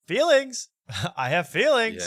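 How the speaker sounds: high-pitched, loud mock hurt; mock outburst. Mimicking the interviewee getting emotional on air, mock outburst. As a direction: blurt it high, as a mocking imitation of wounded pride. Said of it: "he would go".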